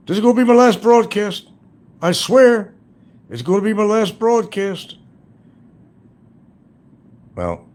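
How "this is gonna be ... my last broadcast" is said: high, loud mock whiny voice; mocking his whine. Mocking the rival's vow, high whiny parody voice. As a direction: parody him swearing it's his last broadcast. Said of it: "Now he's speaking about Saturday".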